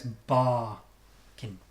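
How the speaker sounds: "barkin" with long strong "bar", small "kin"; slow strong-weak "barkin"; first-syllable stress. Shown as a demonstration. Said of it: "One is always much stronger".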